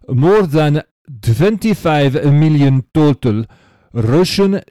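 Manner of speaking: emphatic put-on Russian accent, measured reading; Dimitri in fake Russian accent. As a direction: read the letter like an American faking Russian. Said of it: "trying to do a Russian accent".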